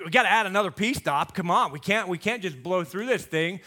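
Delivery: ordinary talk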